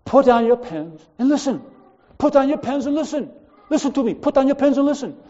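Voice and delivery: put-on high, loud accented command to the class; Chinese accent. Math instructor orders distracted students to listen, high loud Chinese accent. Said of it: "he says in his accent".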